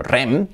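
"rem" as one word with rolled R; Spanish-style RREM; Spanish pronunciation. Shown as a demonstration. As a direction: say rem as one trilled syllable. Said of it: "dicho en español".

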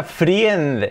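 "friend" with a separate i, like fri-end; misread "friend". Shown as a demonstration. Teacher warns about a word, misread "friend". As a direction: sound out both vowels in "friend". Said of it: "Cuidado esta palabra que no sea".

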